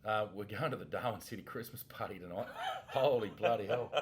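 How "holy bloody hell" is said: laughing. Real, not acted. Laughing about Christmas party.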